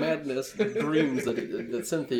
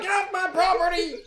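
high-pitched outraged holler; indignant character shout; angry yell; 4 dB louder than usual. Acted. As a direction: yell it like an old man chasing off trespassers. Old man yells at visitor.

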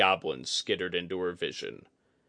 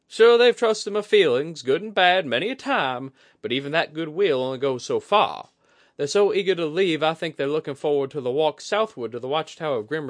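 high, bright-edged woman's voice, much higher; Kudaka voice; 8 semitones higher, 9 dB louder than usual. A narrator's reading.

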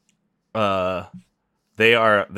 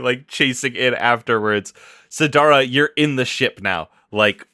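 ordinary delivery

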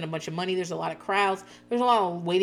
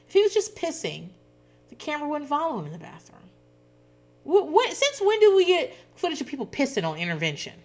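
incredulous; 9 semitones higher than usual. Real, not acted. Incredulous rant about footage.

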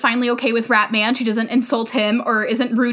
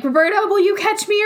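mock scared kid; 9 semitones higher than usual. Voicing the boy hanging off roof.

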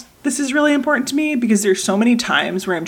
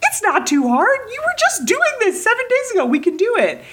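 impatient parent; 14 semitones higher than usual. Retold or imitated. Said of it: "part of me that wanted to be like".

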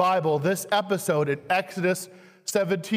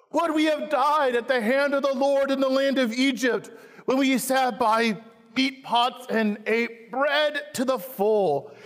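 higher, whining complaint; complaining Israelites; 6 semitones higher than usual. Acted. Preacher voicing Israel's complaint, higher and whining. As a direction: whine like a hungry crowd.

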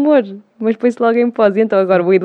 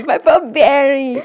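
slow, higher, louder put-on announcing voice; mock announcer; 5 semitones higher, 4 dB louder than usual. Mimicking how the prize was announced at the club gala, slow and sing-song. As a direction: call out the winner's name like a cheesy host. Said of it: "Era assim".

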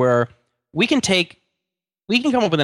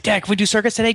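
put-on eager kid; 8 semitones higher, faster than usual. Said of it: "he's like".

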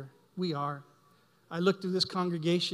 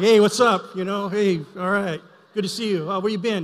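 hearty greeting; 3 semitones higher, 9 dB louder than usual. Retold or imitated. Welcoming a brother back to church, loud cheery hello. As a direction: greet a returning friend with big energy. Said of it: "other brothers welcome me back".

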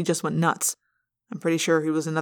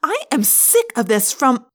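higher, angry outburst; raised voice; 6 semitones higher, 4 dB louder than usual. A narrator's reading. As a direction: start yelling, fed up. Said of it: "Rachel started to raise her voice".

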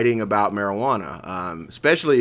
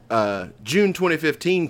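usual speaking voice

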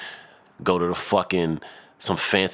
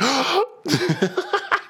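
breathless gasp. Real, not acted. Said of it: "Did I nail it?".

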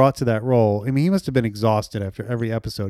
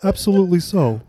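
Mamet voice; 4 semitones higher than usual. Retold or imitated. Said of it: "You got a really good Mamet there".

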